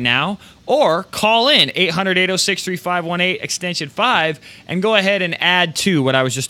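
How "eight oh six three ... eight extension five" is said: usual speaking voice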